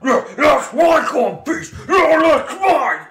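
loud, high greedy orc yelling; squabbling orc shout. Acted. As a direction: fight over the coin, shouting.

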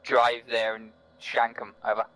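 slow, loud, measured radio voice; deliberate report over the net; calm radio report. Real, not acted.

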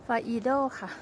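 ฟาอีโด said the Thai way, with Thai tones on each syllable; ฟาอีโด in a Thai accent, falling at the end. Shown as a demonstration. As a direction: give ฟาอีโด a plain Thai-accented reading. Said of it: "ออกสำเนียงไทย".

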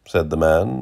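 plain reading voice

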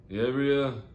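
put-on girl's answer. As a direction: voice the crash victim giving a name. Voices girl giving her name.

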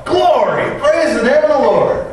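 high, ecstatic exclamation of praise; excited shout. Retold or imitated. Visitor in the parsonage reading and shouting, joyful. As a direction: shout it out in sudden joy.

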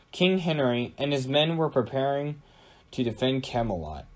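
ordinary delivery, nothing marked

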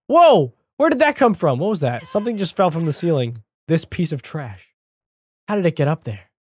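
ordinary talk

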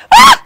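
sudden high yell of effort; a burst of a scream as he forces her; sharp shout. Acted. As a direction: cry out hard, high and loud. Violent struggle over drug.

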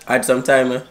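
put-on Jamaican patois accent. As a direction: chat in a fake Jamaican accent. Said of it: "Stop that fake ass accent".

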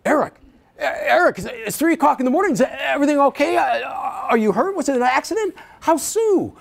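a father woken at night fearing the worst; alarmed dad. Retold or imitated. Dad woken by a late call, alarmed and pressing. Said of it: "Suddenly, he gets really, uh, alert".

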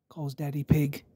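ordinary delivery

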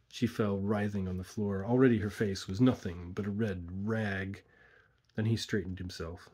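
ordinary delivery

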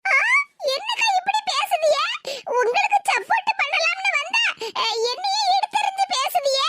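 high-pitched, affronted complaint; hurt indignation. Acted. Neighbour rebuffed after offering support to her friend, hurt indignation. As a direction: protest in a high, wounded voice.

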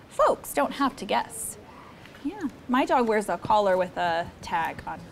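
usual speaking voice

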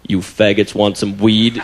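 deep, slow dealer's pitch; gruff drug dealer. Retold or imitated. Dealer pitching weed to two passing guys, deep slow voice. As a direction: drop low and slow like a street dealer. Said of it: "he goes".

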